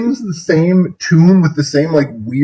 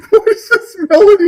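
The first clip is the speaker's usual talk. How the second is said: laughing in disbelief; asking through laughter. Real, not acted.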